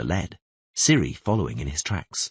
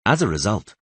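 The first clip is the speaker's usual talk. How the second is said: plain reading voice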